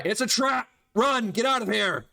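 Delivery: high-pitched urgent yell to comrades; acted cry of warning. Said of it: "you hear him yell out".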